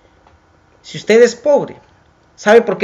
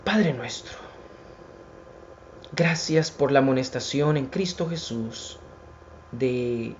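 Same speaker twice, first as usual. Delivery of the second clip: hushed prayer; 4 semitones lower, 8 dB quieter than usual. Acted. Preacher closing with a prayer for his listeners, soft and reverent. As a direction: pray quietly, as if alone with God.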